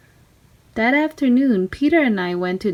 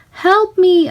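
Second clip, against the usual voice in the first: much higher, louder cry for help; straining call; 11 semitones higher, 7 dB louder than usual. Acted. Girl struggling with a kite in strong wind, straining high shout.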